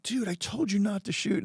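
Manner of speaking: a dying man's feeble, high complaint; dying hunter. Retold or imitated. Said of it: "he's like".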